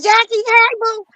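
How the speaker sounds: high, sing-song imitation of a friend's greeting; imitating Treva's greeting. Imitating Treva gushing hello on the phone, high and bright.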